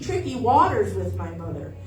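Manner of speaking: ordinary talk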